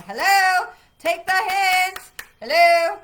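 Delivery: loud, high mock hello, slow on take the hint; cards calling out. The tarot cards calling to a viewer who misses the hint, loud high mock hello. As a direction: call out like the cards nudging you, loud and high. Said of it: "the cards are hitting you on the head going".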